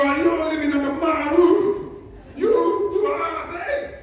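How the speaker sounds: hammed-up angry dad; angry dad routine. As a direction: owner lecturing the cat as a mock angry dad. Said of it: "A time for the angry dad routine".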